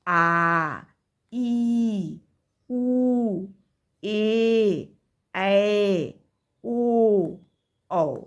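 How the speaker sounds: आ ई उ ए ऐ ओ औ each held twice as long, mouth wide open; long vowels drawn out. Shown as a demonstration. Teacher shows pupils long vowels, each vowel drawn out. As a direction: stretch every vowel, आ to औ, one by one with a pause. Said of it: "ह्रस्व स्वर से दुगुना हमारा मुंह खुला".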